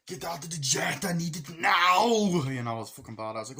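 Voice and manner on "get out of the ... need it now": loud, high movie-hero demand, stretching NOW; mock commanding shout. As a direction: imitate a hero urgently ordering someone out.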